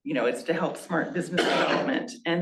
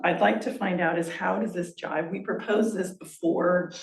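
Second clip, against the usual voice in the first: ordinary talk, nothing marked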